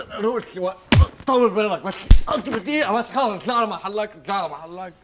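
high, irritated scolding of the trainee; mock angry coach. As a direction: snap at him to calm down and go back. (مدرب يوبّخ المتدرب بعصبية مصطنعة)

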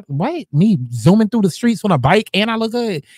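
usual speaking voice

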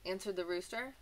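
bright, lively storybook voice; reading to children. A narrator's reading. Teacher reading a picture book to children, lively. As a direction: read it like a teacher to little kids.

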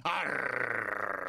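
low pirate growl. Acted.

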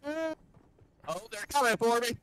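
high, loud panic; panicked. Real, not acted. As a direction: squeal in panic. Enemies approaching.

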